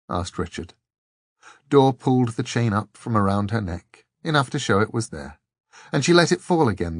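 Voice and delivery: plain reading voice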